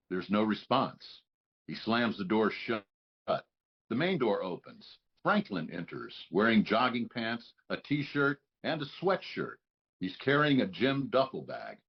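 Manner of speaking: usual speaking voice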